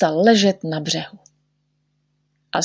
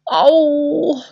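high acted "ow"; long high-pitched ouch; cry of pain; 7 semitones higher than usual. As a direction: yelp as if hurt. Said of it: "Co křičíš, jako bych tě řezal?".

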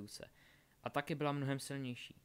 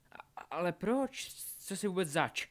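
stammered start, higher and louder; alarmed disbelief; 7 semitones higher, 6 dB louder than usual. A narrator's reading. Bound Albus asking Delphi who she is, alarmed. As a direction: demand an answer in shock.

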